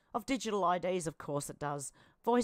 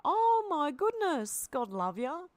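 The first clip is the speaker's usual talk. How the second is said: delighted gush; 7 semitones higher, 5 dB louder, slower than usual. Real, not acted. Reacting to new kittens.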